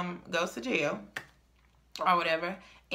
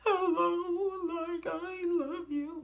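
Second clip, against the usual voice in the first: high sing-song lovesick voice; mock clingy girlfriend; 12 semitones higher, much slower than usual. Mocking the girl who begs her ex, sing-song. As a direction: croon it like a desperate girl. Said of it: "I'm not about to be".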